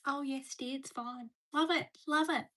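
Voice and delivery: lightly voiced, quiet old man pottering along; a hint of a contented old gent; little old man. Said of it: "like a little old man just going".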